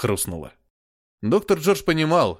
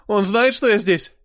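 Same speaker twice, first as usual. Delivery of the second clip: high, loud panicked question; frightened; 9 semitones higher, 5 dB louder than usual. A narrator's reading. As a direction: ask in sudden panic. Scared boy asks about father.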